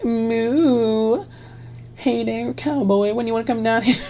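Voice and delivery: high-pitched camp cowboy voice after a moo; hammed-up, very high flirty cowboy.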